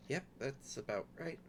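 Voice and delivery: quiet resigned sigh into a reply; Safia, resigned. Acted. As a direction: sigh long, then softly confirm it. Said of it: "and it's just like".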